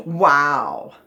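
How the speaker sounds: loud, drawn-out wow of amazement; exclamatory wow. Shown as a demonstration. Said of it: "That was an exclamatory sentence".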